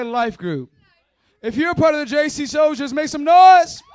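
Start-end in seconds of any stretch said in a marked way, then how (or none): 1.4-3.8 high, full-voiced shout; hyped crowd rallying. Real, not acted. Preacher calling church groups to cheer, hyped shout. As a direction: rev up the crowd like an emcee.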